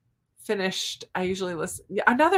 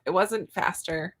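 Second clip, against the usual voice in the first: ordinary delivery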